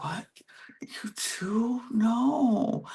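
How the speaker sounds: high breathy whisper of disbelief; whispered shock. Retold or imitated. Roxy whispering surprise at Ferran's suspicion, hushed shock. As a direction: gasp it under your breath. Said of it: "so Roxy's like".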